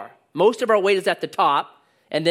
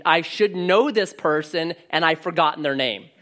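ordinary talk

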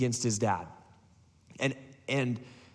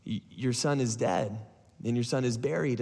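low, subdued delivery; solemn, lowered messenger's voice; grave news; 6 semitones lower than usual. Retold or imitated. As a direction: break the death gently, with pauses. Messenger breaks news of death.

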